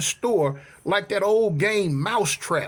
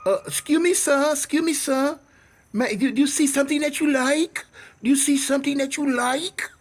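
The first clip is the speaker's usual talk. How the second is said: put-on higher-pitched accented shopkeeper offering help; imitated store lady, pitched up; Mama San; 4 semitones higher than usual. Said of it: "I call her Mama San".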